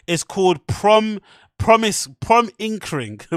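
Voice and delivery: "Prom Inkring" said slowly, clipped, imitating Brendan; mock mispronunciation of "Promising"; mimicking Brendan's mispronunciation. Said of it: "that's how Brendan pronounces interesting".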